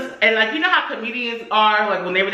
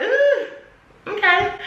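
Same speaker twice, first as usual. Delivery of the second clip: high, drawn-back "ooh"; mock taken-aback squeal; 12 semitones higher, much slower than usual. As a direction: react high-pitched to an insult.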